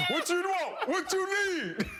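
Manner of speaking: high squeaky voice through laughter; mock answer through laughter; high laughing answerer. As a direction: play the startled friend behind the door, giggling. Mimicking answering the door.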